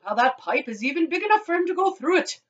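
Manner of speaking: puzzled father. Acted. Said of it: "said Mister Gloop".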